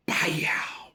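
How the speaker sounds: breathy imitated punch; whispered punch sound. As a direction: mimic the hit with a sharp breath.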